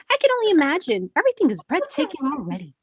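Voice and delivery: high-pitched, put-on extrovert enthusiasm; extrovert gushing. Player answers the hiker about the waterfall, high extrovert gushing. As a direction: gush it brightly, pitched well up. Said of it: "This is me being my extrovert self".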